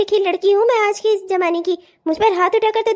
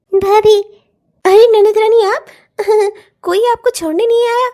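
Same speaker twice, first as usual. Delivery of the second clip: mocking; 5 dB louder than usual. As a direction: greet her with a sneer. Sister-in-law mocks arriving bhabhi. (भाभी पर तंज कसती ननद)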